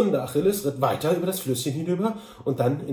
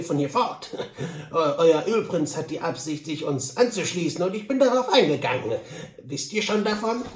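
higher, lilting character voice; Sam Hawkins; 5 semitones higher, 4 dB louder than usual. Acted. Sam Hawkins questions Mister Duncan, chuckling and higher. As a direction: ask with a chuckle in the voice.

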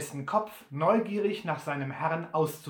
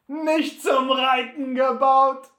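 viel lauter, höher, langsam höhnisch; höhnisches Auflachen; 8 semitones higher, 10 dB louder, slower than usual. Acted. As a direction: Butler lacht laut und spöttisch auf. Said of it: "lachte Butler auf". (Butler wiederholt Sams Worte höhnisch und laut)

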